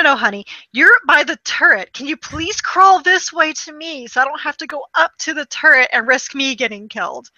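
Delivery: usual speaking voice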